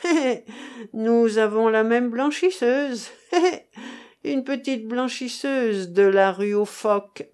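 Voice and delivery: put-on sly old rogue chuckling through; old prospector. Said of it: "gloussa le vieillard".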